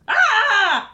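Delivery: high straining squeal; strained squeal. Acted. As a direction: yelp as if a rope yanks you. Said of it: "went the Lord of Mischief".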